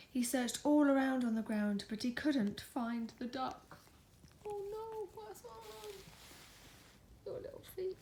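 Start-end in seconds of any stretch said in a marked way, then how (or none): 4.4-8.0 higher and much softer; gentle concerned coo; soft surprised coo. Real, not acted. Parent cooing over child's little feet.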